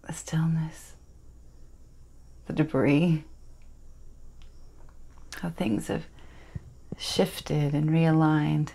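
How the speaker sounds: soft, breath-heavy stage reverie; hushed dramatic monologue. Acted. Miranda recalls stormy nights to her listener, hushed and wistful. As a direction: draw it out softly, with long pauses.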